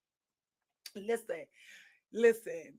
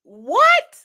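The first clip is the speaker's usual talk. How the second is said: retold what; 7 semitones higher, 9 dB louder than usual. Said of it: "I said".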